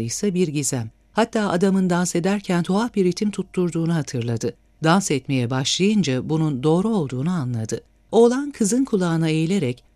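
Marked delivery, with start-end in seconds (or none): none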